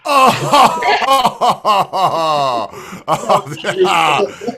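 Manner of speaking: laughing hard. Real, not acted. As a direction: crack up and laugh it out. Cracks up at the joke.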